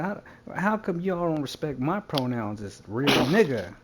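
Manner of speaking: high, loud demand; indignant protest. Retold or imitated. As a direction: argue it like a wronged man. Retells arguing back at bar.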